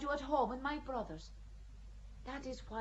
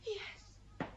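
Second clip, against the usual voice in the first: a breath of a whispered yes; 6 semitones higher, 12 dB quieter than usual. Acted. Joan answering the chaplain, a numb whisper. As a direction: barely voice it.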